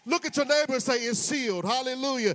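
loud, punching preacher's cadence; full-force preaching. Acted. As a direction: hammer each line home.

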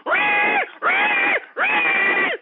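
very high shouted pig squeal. Retold or imitated. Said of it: "Squeal like a pig".

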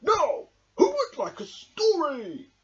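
high, slow, kindly offer; tender storyteller Badger; gentle Badger. A narrator's reading. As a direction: coax the little ones calmly. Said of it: "said Badger gently".

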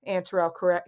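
usual speaking voice

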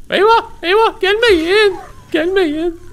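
высокий испуганный писк, наигранный страх; mock panic. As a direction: squeal in mock fright. Scared of approaching creatures.

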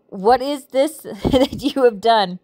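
slow scripture line broken by a real laugh; amused, giggle breaking into the verse; laughing while reading. Real, not acted. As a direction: read it while trying not to laugh. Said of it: "I'm sorry I'm laughing".

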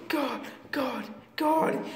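whispered frightened "God, God"; panicked whisper. Acted. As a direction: breathe it out in fear.

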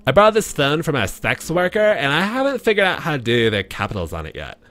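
put-on high California girl voice; valley girl. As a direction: read it as a ditzy California girl. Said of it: "California girl Katie Collins right here".

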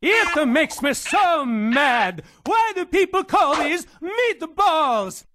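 put-on slow, loud comic rant in a Swedish accent. The ranting Swede fumes at viewers about meatballs, slow and loud in a Swedish accent. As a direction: blow up over meatballs like it's a personal insult. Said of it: "with a ranting Swede".

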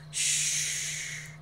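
long unvoiced shush. A narrator's reading. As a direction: hush someone with a drawn-out breath. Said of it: "tries to shush me".

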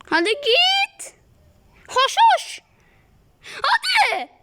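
very high, loud, slow shooing shouts breaking into a laugh; frantic shooing. Real, not acted. Gamer shooing the dinosaur away, shrieking and laughing. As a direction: shoo it away at the top of the voice.

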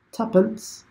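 put-on high, chirpy English accent on one word; mock English accent. To the English players, a mock cockney English word. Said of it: "Is that how you guys sound?".

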